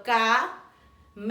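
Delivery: ca-me-lo split slowly into syllables. Shown as a demonstration. Teacher segmenting syllables for children, slow. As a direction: break ca-me-lo into three slow syllables. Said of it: "a primeira sílaba".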